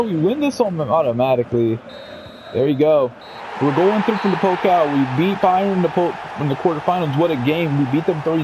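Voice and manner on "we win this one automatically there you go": usual speaking voice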